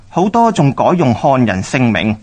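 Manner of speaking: ordinary talk, nothing marked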